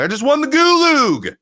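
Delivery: "gulug" said high, slow and loud, twisting gulag; silly word change. Shown as a demonstration. Bragging about a win with a silly word. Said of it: "that's my thing".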